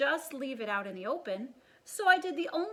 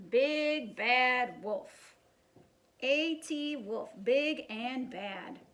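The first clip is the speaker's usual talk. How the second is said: slow mock headline; much slower than usual. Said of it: "wolf mocks his headline name".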